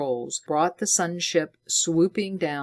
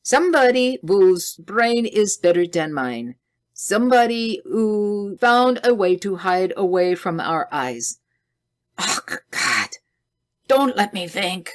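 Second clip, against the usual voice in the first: put-on anguished German accent, higher and louder; 4 semitones higher, 5 dB louder than usual. A narrator's reading. Scientist fearing a hidden enemy, heavy German accent. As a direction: let the German accent thicken under stress. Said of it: "His Teutonic accent grew more pronounced".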